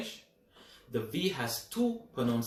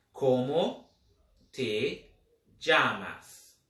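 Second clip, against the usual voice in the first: slow word by word; 3 dB louder, much slower than usual. Shown as a demonstration. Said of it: "¿Cómo se dice".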